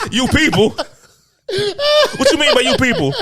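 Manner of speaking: loud, high, drawn-out offended question; hammed-up indignant disbelief. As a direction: act outraged through laughter, stretching it out. Acted outrage at "you people.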